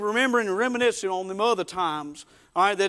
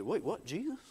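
soft, halting disbelief; stunned question; 2 semitones lower, 12 dB quieter than usual. Acted. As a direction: ask it under your breath, taken aback. Simon's stunned reaction, acted.

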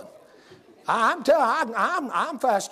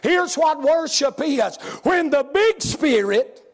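full-force preaching; 5 semitones higher, slower than usual. Acted. Preacher telling the congregation what worship is, full-force preaching. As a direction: preach it with fire.

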